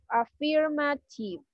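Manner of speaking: slow, drawn-out Affirmative; slow teaching model. Shown as a demonstration. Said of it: "otra affirmative". (pronunciación lenta y modelada)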